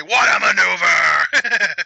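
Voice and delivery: loud, drawn-out commentator call; hammed-up wrestling commentator hollering a big move. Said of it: "That's commentator".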